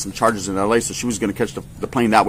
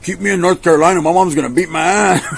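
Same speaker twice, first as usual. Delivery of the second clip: higher, louder panicked plea; pleading; 6 semitones higher, 4 dB louder than usual. Retold or imitated. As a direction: beg like a scared kid. Begging to stay.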